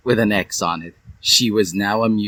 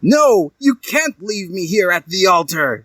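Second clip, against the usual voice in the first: high, louder pleading cry; upset shout; 9 semitones higher, 4 dB louder than usual. A narrator's reading. Jilted groom Hiei shouts at fleeing Dali. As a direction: yell it as he's left behind. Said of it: "shouted Hiei in upset".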